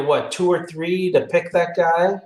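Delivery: ordinary talk, nothing marked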